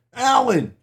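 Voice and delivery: high, loud shout across the room; startled resident yelling; yelled. Retold or imitated. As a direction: call out loudly at Alan.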